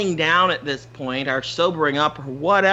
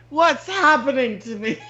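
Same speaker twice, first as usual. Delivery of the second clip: high-pitched mock bewilderment; higher, hammed-up helpless dismay; shocked dismay; 6 semitones higher than usual. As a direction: cry out in playful shock and dismay. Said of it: "just of shock and dismay".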